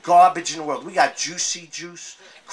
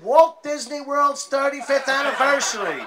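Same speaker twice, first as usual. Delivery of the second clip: wife's answer; 10 semitones higher, 4 dB louder than usual. Acted. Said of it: "She said".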